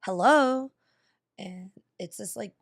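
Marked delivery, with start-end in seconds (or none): 0.1-0.7 louder, raised-pitch hello; phone-answering hello. Retold or imitated. Storyteller re-enacts picking up a weird call, loud hello. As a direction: answer the nagging phone, imitated.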